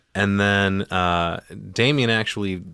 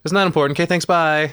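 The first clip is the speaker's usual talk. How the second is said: higher, louder mock rushed goodbye; hurried brush-off; 9 semitones higher, 5 dB louder, faster than usual. The priest dodging the mother's question with a mock quick goodbye. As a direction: toss it off fast and escape the question. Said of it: "He's like".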